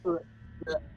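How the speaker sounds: kitty coughing up a hairball; hairball hacking. Acted. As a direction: hack like a cat with a hairball. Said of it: "Just making kitty, like, uh, hairball noises".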